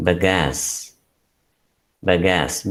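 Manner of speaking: "bagasse" modelled on its own, stress on the second syllable; pronunciation model. Shown as a demonstration. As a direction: say "bagasse" carefully as buh-GASS. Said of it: "It is pronounced as".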